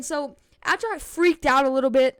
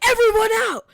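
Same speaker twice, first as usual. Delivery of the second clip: high, loud order; reenacted alarmed command; urgent teacher's shout; 9 semitones higher, 6 dB louder than usual. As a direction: imitate a teacher clearing the room. Teacher clearing the room urgently.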